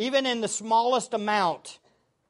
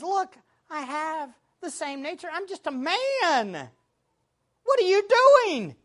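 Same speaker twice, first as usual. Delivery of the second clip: high-pitched imitated pleading; acted exasperation, pitched up; mock protest; 7 semitones higher than usual. Paraphrasing Paul protesting to crowd.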